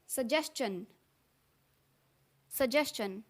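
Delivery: "सजेशन" said suh-JES-chun; no hard g, "chun" ending in "सजेशन"; alternative pronunciation. Shown as a demonstration. Teacher gives another correct option, "सजेशन" as suh-JES-chun. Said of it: "या फिर आप कह सकते हैं".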